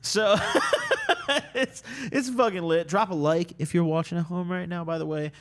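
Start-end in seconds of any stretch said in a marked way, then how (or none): 0.4-1.5 high, giddy burst of laughter. Real, not acted. Laughs after admitting being drunk.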